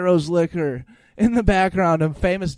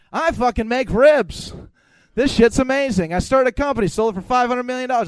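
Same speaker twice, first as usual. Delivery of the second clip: imitating a guest bragging, higher and louder; high energy; 6 semitones higher, 6 dB louder than usual. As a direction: pump it up like a hyped boaster. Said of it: "went on another level, like, energy-wise".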